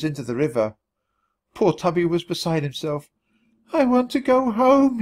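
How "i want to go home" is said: high, slow, tearful plea; sobbing. A narrator's reading. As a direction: whimper it like a crushed mouse. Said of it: "he sobbed".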